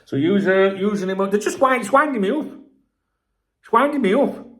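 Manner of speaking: high, loud wound-up outburst; exasperated. Real, not acted. Streamer fed up with commenters, exasperated. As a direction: voice climbing high in irritation.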